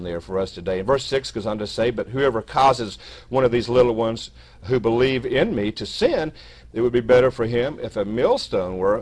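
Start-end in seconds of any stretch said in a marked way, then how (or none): 1.9-9.0 loud, emphatic Bible reading; scripture reading. Acted. Preacher reads Jesus's warning to brethren, loud scripture reading. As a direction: read out the warning with weight.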